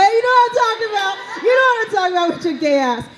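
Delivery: high-pitched teasing call-out; mock accusation. Comic calling out someone shaking their head, high mock accusation. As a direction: call them out, voice shooting way up. Calls out a nodding audience member.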